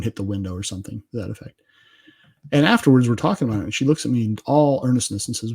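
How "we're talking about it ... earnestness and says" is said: ordinary talk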